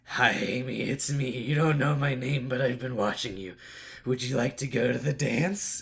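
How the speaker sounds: put-on slow, halting creepy-boy voice; lightly voiced awkward suitor. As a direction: ask her out slowly and stiffly. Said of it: "he's like".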